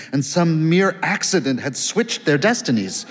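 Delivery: plain reading voice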